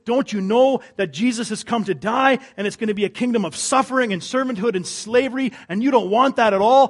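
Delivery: loud, high, fervent preaching; impassioned pulpit voice; preaching at full force. Acted. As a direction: drive the rebuke home to the congregation. Preacher voicing a rebuke.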